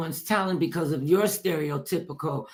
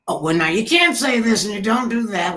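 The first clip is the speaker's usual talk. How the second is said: higher, louder mock telling-off; mock scolding; 5 semitones higher, 5 dB louder than usual. Bosses correcting her after she signed the contract, mock scolding. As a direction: imitate a boss laying down rules.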